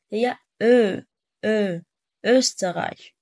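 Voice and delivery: أو said as an open ö, lips loosely rounded; unrounded German ö. Shown as a demonstration. Teacher models the Austrian word's vowel, clear open ö. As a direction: stretch the ö in أوستريش without puckering it into u. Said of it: "مش مضمومة".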